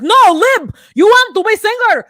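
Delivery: put-on shouted, high foreign accent in broken English; hammed-up husband yelling in a bad foreign accent; botched foreign accent. Husband urging his wife to sing, loud botched accent. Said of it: "That's not the accent".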